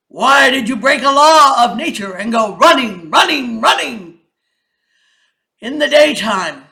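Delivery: loud, commanding king's voice; stern lion king. Acted. King questions the rabbit, loud and stern. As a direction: demand an answer from the throne.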